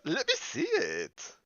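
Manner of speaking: put-on high, slow girlish voice; sing-song young girl. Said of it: "she said".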